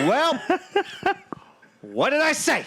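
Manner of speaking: high mock gloating voice; smug told-you-so. Co-host imitating him gloating about the explosion. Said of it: "and you're like".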